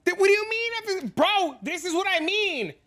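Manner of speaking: high, strained disbelief at a silly question; exasperated squeal. Real, not acted. Streamer answering chat's question, high exasperated squeal. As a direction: squeak out your frustration at chat.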